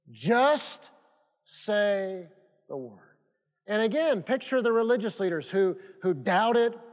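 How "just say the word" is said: long pause after a drawn-out JUST; slow, emphatic. Shown as a demonstration. Preacher driving home great faith, slow and emphatic. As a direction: hold "Just" and "say", let "the word" fall away. Preacher repeating the key verse.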